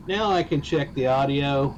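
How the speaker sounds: usual speaking voice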